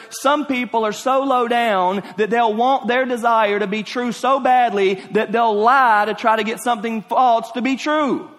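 high, insistent preacher's voice; forceful preaching. Real, not acted. Preacher decrying people who lie for their desires, forceful. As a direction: press every phrase on the congregation.

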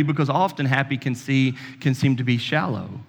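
ordinary talk, nothing marked

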